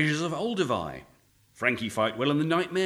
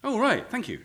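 usual speaking voice

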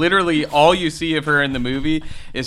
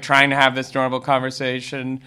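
ordinary delivery

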